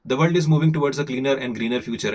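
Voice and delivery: brisk, quick Indian accent read. Shown as a demonstration. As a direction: speed through the line in an Indian accent. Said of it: "this time I will be a little faster".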